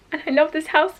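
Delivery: fighting real tears over the house; getting emotional. Real, not acted. Vlogger telling viewers she loves her house, voice breaking. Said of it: "I'm gonna try not to get emotional".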